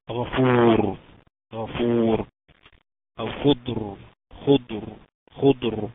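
غفور and خضر ending on a full, heavy R; heavy ra at a stop. Shown as a demonstration. Teacher giving pause examples after damma, heavy ra. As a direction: recite خضر firmly, stopping on a thick vowelless ر. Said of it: "ساكنة وقبليها ساكن وقبليها مضموم، تفخم".

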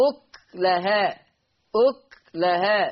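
أكلها slowly with a silent kaf; slow أكلها, kaf held still; أكلها بسكون الكاف; sukun on kaf. Shown as a demonstration. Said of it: "بسكون الكاف".

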